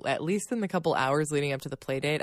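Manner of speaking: ordinary talk, nothing marked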